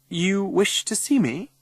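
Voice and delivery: high, slow, poised question; formal headteacher. A narrator's reading. Head greeting an angry visitor, formal and poised. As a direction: ask with measured formality.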